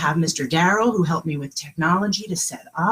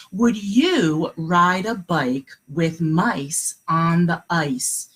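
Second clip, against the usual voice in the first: slow read-aloud; 3 semitones lower, much slower than usual. Shown as a demonstration. Teacher reading a secret message to her class, very slowly. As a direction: read each word slowly for little listeners. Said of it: "I'm gonna read it one more time".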